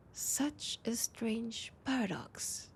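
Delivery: slow, hushed whisper. Acted. Vampire confiding her mixed feelings, in a slow whisper. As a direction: murmur it slowly as a private musing.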